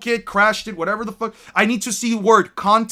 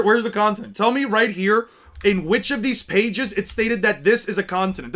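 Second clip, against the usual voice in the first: usual speaking voice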